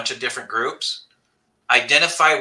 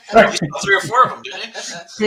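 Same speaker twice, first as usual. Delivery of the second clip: high, louder speech broken by laughs; laughing; 7 semitones higher, 4 dB louder than usual. Real, not acted. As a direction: laugh through the words. Laughing at teasing.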